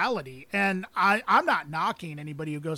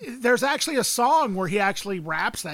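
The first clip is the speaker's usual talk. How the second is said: ordinary delivery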